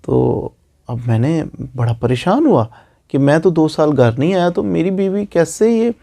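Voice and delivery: ordinary talk, nothing marked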